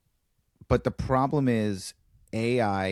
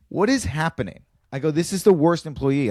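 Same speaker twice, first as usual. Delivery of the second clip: higher, louder disbelief; exasperated; 7 semitones higher, 4 dB louder than usual. Retold or imitated. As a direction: throw up your hands in outrage. Exasperated at restaurant.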